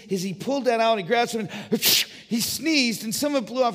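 high, loud storytelling build; excited preaching. Acted. Preacher tells the congregation of God making him, excited. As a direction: rattle off the craftsman's steps with mounting glee.

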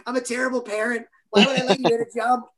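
high, strained, half-sobbing apology; mock-tearful high-pitched "I'm so sorry"; retold crying apology. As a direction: relive the panicked dad apologizing to his hurt daughter. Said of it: "And I was, I'm crying".

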